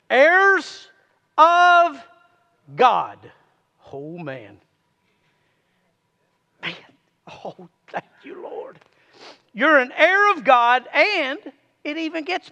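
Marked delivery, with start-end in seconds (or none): 0.1-3.3 louder, higher on Heirs, drawn out; each word set apart with pauses; preaching emphasis. Real, not acted. 3.9-8.8 unvoiced whisper of thanks; hushed aside to the Lord; whispered praise. Real, not acted.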